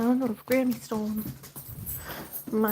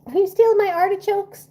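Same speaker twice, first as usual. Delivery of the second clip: playful scolding; 10 semitones higher, 7 dB louder than usual. Real, not acted.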